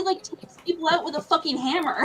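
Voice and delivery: ordinary delivery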